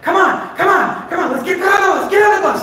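very high, shouted pleading; impatient urging. Retold or imitated. Wife hurrying reluctant husband onto bus, shouting impatiently. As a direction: shout them aboard in rapid high bursts.

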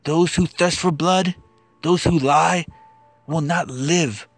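ordinary talk, nothing marked

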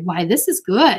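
usual speaking voice

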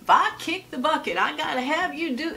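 put-on a light southern accent for Dad's line. Dad bringing up his death over lunch, mock southern accent. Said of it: "he has a southern accent".

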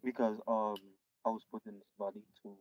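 very faint, far from the mic; words almost lost in quiet; barely audible. Real, not acted. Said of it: "Your voice is really low".

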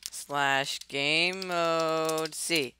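very slow, drawn-out command; slow dictation. Real, not acted. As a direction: spell out each word slowly. Typing a command aloud, slowly.

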